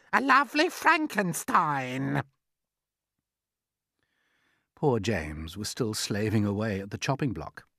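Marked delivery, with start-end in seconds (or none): 0.1-2.2 high, ringing punchline; mocking aunt. A narrator's reading. Aunt Spiker topping her sister's boast, mocking. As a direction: land the insult high. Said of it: "Aunt Spiker said".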